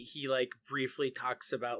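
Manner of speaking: ordinary talk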